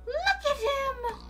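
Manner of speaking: high, slow, drawn-out gush; adoring squeal. Real, not acted. Gushing over caught creature.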